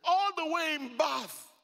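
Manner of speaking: high, drawn-out cry of amazement; incredulous exclamation. Real, not acted. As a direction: call it out high and slow in disbelief. Amazed at the unlikely meeting place.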